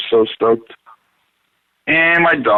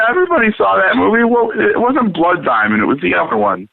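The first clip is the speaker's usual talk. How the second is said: ordinary talk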